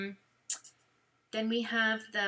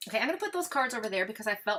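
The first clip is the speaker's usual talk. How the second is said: ordinary delivery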